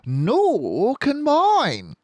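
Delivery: hint at a secret; sly Fox voice. A narrator's reading.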